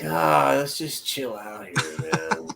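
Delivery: slow, soft laid-back drawl; sleepily chill, like a lazy sloth. Pretend. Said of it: "he'd just be like".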